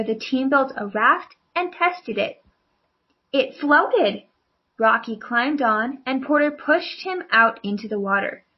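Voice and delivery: ordinary delivery, nothing marked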